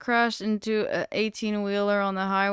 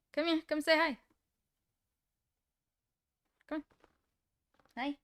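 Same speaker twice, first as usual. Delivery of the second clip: high, soft coaxing call; high sweet come-here to pet; coaxing dog call; 9 semitones higher, 6 dB quieter, faster than usual. Real, not acted. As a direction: sing it out to coax the dog. Calling the dog over.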